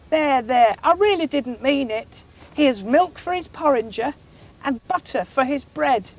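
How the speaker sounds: a hint of a sorry cow, soothing.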